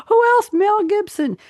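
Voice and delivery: high gasping mock amazement; mock surprise. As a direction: gasp as if it's a big reveal. Mock gasp at the obvious answer.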